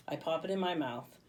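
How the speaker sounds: plain reading voice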